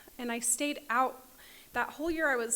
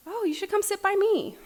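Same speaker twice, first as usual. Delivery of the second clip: higher, sweet inviting voice of a kind woman; kind welcome; 10 semitones higher, 6 dB louder than usual. Retold or imitated. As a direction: welcome a scared newcomer gently.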